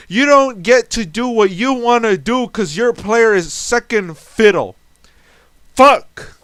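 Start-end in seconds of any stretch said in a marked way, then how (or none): 5.7-6.3 loud exasperated curse; frustrated yell. Real, not acted. Cursing at the dice roll, loud frustrated yell. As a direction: spit it out in frustration. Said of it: "I'm fucking yelling at this point".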